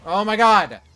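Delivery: real high, very loud outcry; exasperated shout. Real, not acted. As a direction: cry out loudly at the game, voice leaping up. Frustrated outburst at game enemies.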